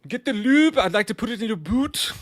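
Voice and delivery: high, loud mock old English; thirteenth century English. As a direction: ham it up like a medieval suitor. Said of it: "tried thirteenth century English".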